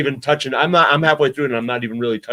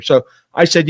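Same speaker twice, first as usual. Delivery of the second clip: ordinary talk, nothing marked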